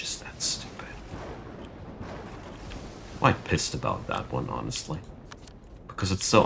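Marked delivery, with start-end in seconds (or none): 0.0-0.9 whispered, trailing off; disgusted whisper. Real, not acted. Streamer cursing the game's bad luck, whispered. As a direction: hiss it under your breath in disgust. Frustrated streamer groans at game.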